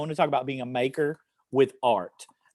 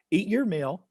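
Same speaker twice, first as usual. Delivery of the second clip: higher, slow, drawn-out action-poem reading; poem read to children; 6 semitones higher, much slower than usual. Acted. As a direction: lead children through each action, slowly.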